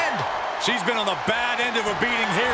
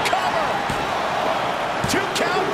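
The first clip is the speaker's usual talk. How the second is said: excited count call; 8 semitones higher than usual. Acted. Calling the pin count.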